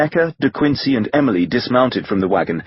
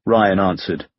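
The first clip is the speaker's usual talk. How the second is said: ordinary delivery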